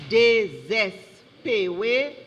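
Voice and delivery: slow, syllables pulled apart; drawn-out, careful pronouncer delivery; slow correction. Real, not acted. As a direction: restate the word deliberately before spelling it. Sorry, you have errors.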